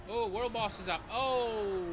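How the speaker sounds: ordinary delivery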